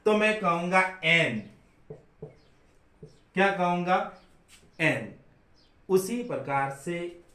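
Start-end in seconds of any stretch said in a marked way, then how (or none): 1.0-1.4 एन said full as en, louder; full strong एन; strong form an. Shown as a demonstration. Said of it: "strong form के अंदर कहना". 4.8-5.1 full strong एन; strong form an. Shown as a demonstration. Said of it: "strong form के अंदर कहना".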